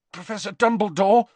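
strained and worried, rising. A narrator's reading. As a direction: call out with a touch of strain. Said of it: "his voice strained".

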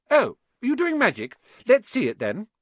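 high, demanding schoolgirl; bossy girl expecting a show. Acted. Girl demanding to see Ron's magic, bossy. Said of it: "She had a bossy sort of voice".

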